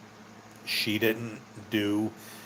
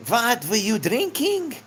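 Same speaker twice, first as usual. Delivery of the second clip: put-on high, slow gruff old German man; high, slow disbelieving old German man scolding; old German guy; 13 semitones higher, 4 dB louder, slower than usual. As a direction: play the stern old German boss. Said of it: "he was an old German guy".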